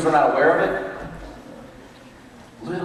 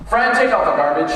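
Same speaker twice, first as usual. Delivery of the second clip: loud, higher barked command; dad's order; 5 semitones higher, 7 dB louder than usual. Retold or imitated. Dad telling Fran to take out the garbage, loud command. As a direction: order the son about.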